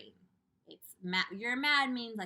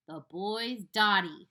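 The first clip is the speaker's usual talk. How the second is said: scornful; 10 dB louder, slower than usual. Acted. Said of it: "said the centipede".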